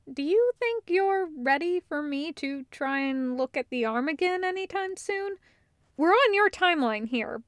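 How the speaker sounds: Hallwinter, tentative. Acted. Hallwinter asking Taco about the arm, hesitant and gentle. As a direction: tiptoe around a sore subject.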